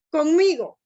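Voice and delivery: teacher's emphatic example of accenting "conmigo"; demonstrated accent. Shown as a demonstration. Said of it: "por ejemplo, un acento, por ejemplo, digo".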